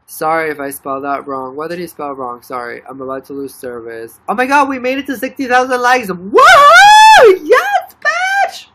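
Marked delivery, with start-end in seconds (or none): 4.3-8.7 much louder, much higher whooping celebration; ecstatic cheering. Real, not acted. Streamer celebrating sixty thousand likes with viewers, loud high whooping. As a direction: scream with joy at the milestone.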